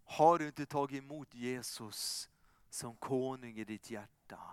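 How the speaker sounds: soft, low, gentle invitation; intimate appeal. Acted. Preacher inviting each listener, softly and sincerely. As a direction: draw the listener in quietly.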